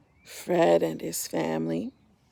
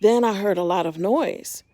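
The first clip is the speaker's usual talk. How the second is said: gentle storybook narration; reading to children; 7 dB louder than usual. A narrator's reading. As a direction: tell it like a bedtime story to little ones. Reading picture book aloud.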